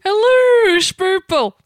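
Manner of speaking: high, loud mock Dutch greeting; lilting, un-scary Dutch hello; bouncy Dutch accent. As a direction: sing it out in silly Dutch. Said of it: "bouncy thing going on with their accent".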